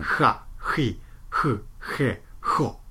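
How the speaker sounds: は ひ ふ へ ほ breathed from the throat, airy; airy throaty Spanish はひふへほ. Shown as a demonstration. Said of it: "スペイン語のハヒフヘホは、".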